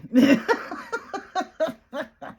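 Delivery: a big high burst of laughter; loud laugh. Real, not acted. Retelling her apology to the boss, bursting out laughing. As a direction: crack up at the mix-up.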